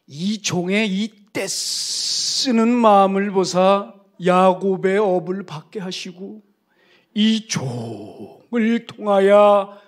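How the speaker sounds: slow, loud, booming prayer of blessing; fervent old pastor's prayer. Retold or imitated. Elderly pastor prays over the kneeling young preacher, slow and booming. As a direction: pray over him like an old revival preacher, stretched and loud. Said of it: "이렇게 기도하시더라고요".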